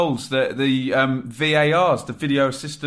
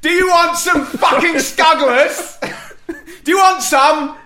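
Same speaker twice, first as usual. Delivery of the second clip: laughing shout; 13 semitones higher, 6 dB louder than usual. Real, not acted. Excited laughing shout, standing up.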